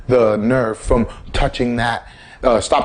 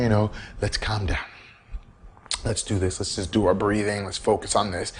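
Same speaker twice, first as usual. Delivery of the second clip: calm self-talk; 3 semitones lower, 6 dB quieter than usual. Retold or imitated. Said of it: "You'll be like".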